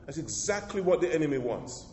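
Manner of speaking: ordinary delivery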